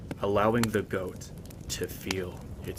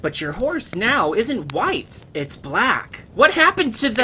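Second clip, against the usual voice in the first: much higher, loud whiny protest; indignant listener; 13 semitones higher, 8 dB louder than usual. Acted. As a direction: protest the ending like an impatient kid. Listener questions the story.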